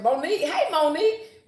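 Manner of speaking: high, loud sing-song hello; excited greeting. Real, not acted. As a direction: call her name out gladly. Greeting a viewer.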